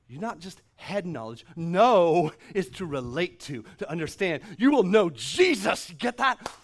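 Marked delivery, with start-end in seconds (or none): 1.5-6.5 louder, emphatic preaching; full-force sermon delivery. Acted. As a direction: proclaim it to the room. Preaching on knowing Jesus.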